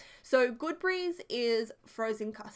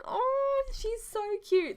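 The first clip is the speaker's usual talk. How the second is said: high, drawn-out gushing "aww"; adoring coo; 12 semitones higher, slower than usual. Retold or imitated. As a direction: coo over someone adorable. Cooing over grandma's sweet reply.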